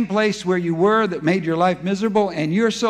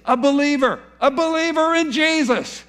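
much higher, disbelieving cry of a believer; incredulous preaching; 9 semitones higher than usual. Real, not acted. Preacher rebuking the congregation, high incredulous cry. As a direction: rise high in shocked disbelief.

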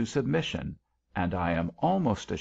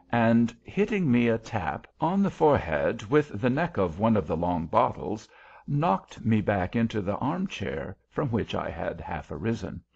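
usual speaking voice

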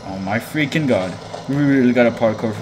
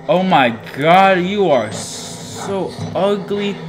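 disgusted; 7 semitones higher, much slower than usual. Real, not acted. Disgusted at monster's face.

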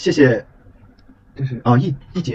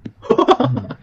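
high laugh. Real, not acted. Laughs at teammate falling.

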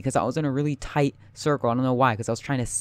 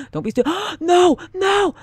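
high loud panicked cries; panicked gasps; 7 dB louder than usual. Real, not acted. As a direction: gasp and cry out in terror. About to be killed.

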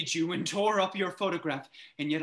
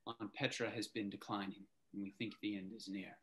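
hushed grave; 9 semitones lower, 14 dB quieter, faster than usual. Acted. Grim news, hushed.

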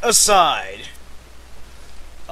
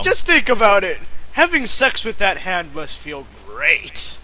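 hammed-up pitch; 5 semitones higher, 6 dB louder than usual. Mock pitch for Kazan.

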